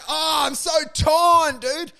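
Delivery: high, drawn-out put-on agonizing; mock torn anguish. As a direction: draw it out slowly, hammed-up and conflicted. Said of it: "how conflicted you'd be".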